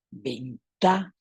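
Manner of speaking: slow "ventana", split around a punched TA; stress on TA. Shown as a demonstration. Teacher locating the strong syllable, TA stressed in slow "ventana". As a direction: lean on the middle TA of "ventana". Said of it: "está en la T".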